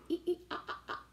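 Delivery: monkey call. Shown as a demonstration. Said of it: "Can you do that".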